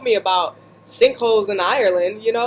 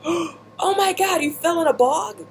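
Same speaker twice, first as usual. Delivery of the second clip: shocked friend; 9 semitones higher than usual. Retold or imitated. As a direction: imitate the friend's shocked gasp. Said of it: "all shocked and stuff".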